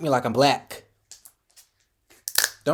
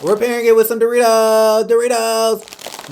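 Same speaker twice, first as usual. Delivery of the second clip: hyped up; 8 semitones higher, 7 dB louder than usual. Real, not acted. Hyped announcing snack.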